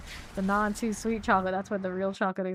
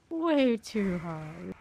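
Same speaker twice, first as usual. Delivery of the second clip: slow drawn-out repeat; much slower than usual. Shown as a demonstration.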